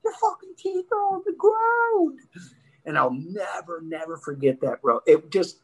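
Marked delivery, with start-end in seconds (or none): 0.0-2.2 high-pitched, retold outcry; mock alarm. Friends looking at the knocked-out teeth, high mock alarm. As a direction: imitate friends crying out in alarm. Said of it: "we're all looking like".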